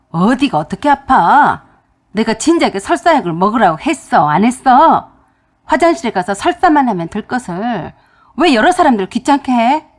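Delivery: loud, harsh scolding rant; angry scolding. Retold or imitated. Husband yelling at his sick wife over speakerphone, loud and harsh. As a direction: berate her for making a fuss.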